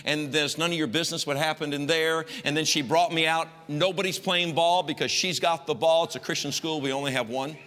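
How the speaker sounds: normal talking voice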